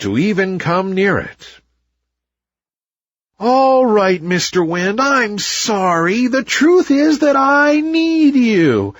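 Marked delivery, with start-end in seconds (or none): 3.4-8.9 high, slow humble apology with a sigh; sighed plea. A narrator's reading. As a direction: give in and admit the need. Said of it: "sighed the copperpod tree".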